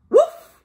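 short, high, loud woof; storybook dog bark. Acted. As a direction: give one sudden playful bark. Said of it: "said Dog".

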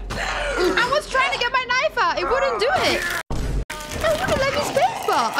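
high-pitched, laughing protest; exasperated whining. Real, not acted. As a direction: whine helplessly about the unfair controls. Complains about failed controls after dying.

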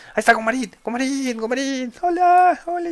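very high, excited falsetto calls; delighted squealing greeting. Real, not acted. Streamer greeting lost Gumarín, high delighted squeal. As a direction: squeal hello to a pet found alive.

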